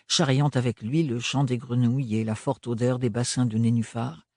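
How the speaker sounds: ordinary delivery, nothing marked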